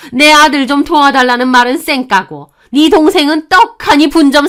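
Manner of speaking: high, loud mother-in-law rant; furious screeching tirade. Acted. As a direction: berate her with one indignant accusation after another. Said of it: "언성을 더욱 높이며 악을 썼습니다". (언성 높여 악쓰는 시어머니)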